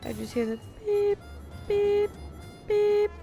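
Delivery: ordinary talk, nothing marked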